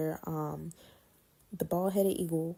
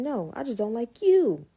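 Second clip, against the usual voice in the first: defiant; 6 semitones higher, 7 dB louder than usual. Retold or imitated. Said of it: "I was like".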